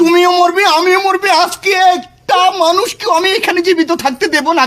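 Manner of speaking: high-pitched frantic threatening; high, desperate threat. Real, not acted. Spurned lover threatening everyone around, high and frantic. Threatens death after being refused.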